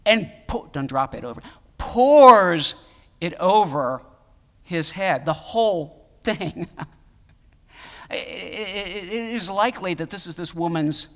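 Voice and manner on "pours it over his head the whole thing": sudden loud stress on pours; emphatic shout. Real, not acted. Preacher stressing the pouring.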